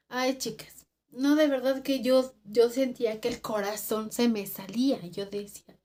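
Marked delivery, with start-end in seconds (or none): none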